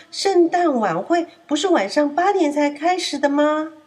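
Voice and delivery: asking in confusion; lightly voiced doubtful lamb; puzzled. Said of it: "羊咩咩觉得奇怪".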